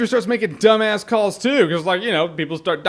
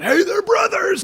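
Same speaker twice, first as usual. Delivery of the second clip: hammed-up shout, much higher, slow; mock on-the-nose movie; 13 semitones higher, slower than usual. Reviewer mocking a clumsy film: hammed-up high shout. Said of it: "it's just the movie going".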